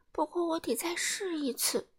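soft, high little voice; quiet thought. A narrator's reading. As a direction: murmur a small resolve to yourself. Timid man thinking to himself. (轻声细语地自言自语)

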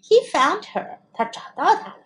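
usual speaking voice

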